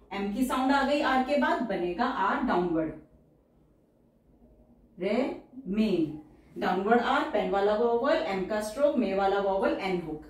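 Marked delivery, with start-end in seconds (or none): 5.0-6.2 remain drawn out very slowly; slow teacher dictation. Shown as a demonstration. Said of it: "teacher says word then dictates".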